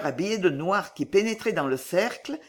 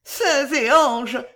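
slow, high, loud cry; distraught stammer; 6 semitones higher, 5 dB louder, much slower than usual. A narrator's reading. Girl calling on saints and angels, high distraught cry. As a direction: appeal to heaven in distress. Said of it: "balbutia Violetta éperdue".

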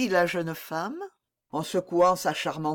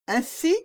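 higher-pitched questioning opener; 7 semitones higher than usual. A narrator's reading. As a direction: break the silence with a tentative question. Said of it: "interrogeant en même temps qu'elle rompait le silence".

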